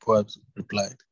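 ordinary delivery

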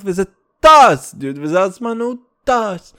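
sudden loud burst; loud exclamation. Real, not acted. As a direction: blurt it out loud. Exclaiming time flies.

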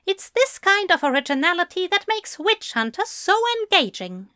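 high-pitched author's jibe; Fell, sarcastic. Acted. Fell jibing at Crowley.

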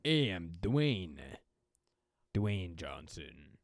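deep, slow tough-guy voice; Dwayne Johnson. Acted. Host joins the celebrity impression, deep and slow. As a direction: sink low and draw each word out. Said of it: "Dwayne The Rock Johnson impression".

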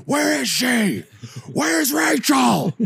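high slow interrogation shout; high mock shout. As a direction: demand answers in a parody voice. Batman interrogation parody.

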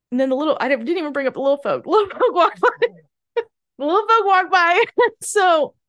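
high, loud, giggly and quick; bursting into laughter mid-sentence; laughing. Real, not acted. Fan remembers the little folk and breaks into loud laughter.

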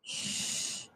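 hushed, breath-only shush; whispered. A narrator's reading. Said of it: "whispered Grandpa Joe".

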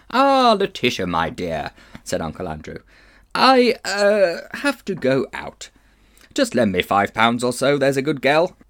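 oily, coaxing Victorian uncle; wheedling old gentleman. Acted. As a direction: sweet-talk her for money. Uncle Andrew wheedling his sister.

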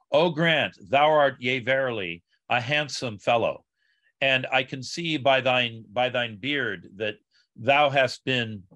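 normal talking voice

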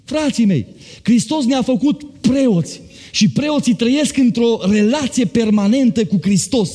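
high, rising preacher's fervour; fervent preaching. Acted. Pastor lifting the church toward Christ's coming, fervent preaching. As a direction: preach it up with mounting fire. Preacher building to full force.

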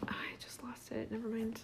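hushed whisper, voice dropped away. Real, not acted. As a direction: whisper it so nobody hears. Quiet aside during recording.

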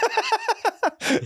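high, squealing giggles; helpless laughter. Real, not acted. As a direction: crack up at your own joke. Cracks up at own joke.

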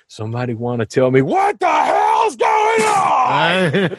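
slow, high overacted shout; hammy stage actor. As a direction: ham it up like a melodramatic soldier. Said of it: "this is one of his overacting moments".

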